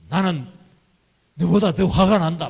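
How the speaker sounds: loud acted anger; 화내듯. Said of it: "화를 더 내버렸어요". (버럭 화내는 시늉)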